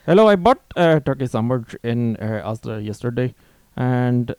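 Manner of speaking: loud, hammed-up Indian accent; angry Indian accent. Customer complaining about soggy sandwich, angry mock Indian accent. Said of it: "Angry Indian wanting to know".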